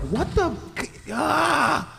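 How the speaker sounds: ordinary delivery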